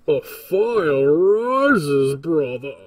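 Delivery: loud, very slow, menacing Bane voice; Bane impression. Shown as a demonstration. Game dev testing character voices, doing loud slow Bane. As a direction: drag it out like a masked villain. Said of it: "So I can do Bane".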